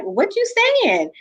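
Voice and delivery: lightly voiced stunned brother; mock bewildered brother. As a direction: play the brother's baffled disbelief. Brother's bewildered look retold.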